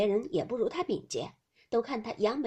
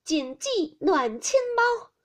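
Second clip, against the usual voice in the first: high, raised-voice verse line, drawn out; loud cry; 9 semitones higher, 5 dB louder, slower than usual. A narrator's reading. Daiyu answering Xiangyun in the verse contest, crying out loudly. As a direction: cry the verse out to beat a rival. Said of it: "高声嚷道".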